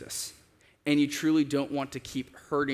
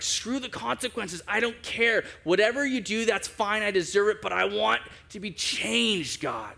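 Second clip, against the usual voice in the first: high-pitched, insistent plea to God, dropping on the last word; impassioned plea; 7 semitones higher than usual. Retold or imitated. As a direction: plead with God, pitch raised, drawing out the final wish. David's desperate plea to God retold.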